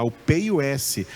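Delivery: ordinary talk, nothing marked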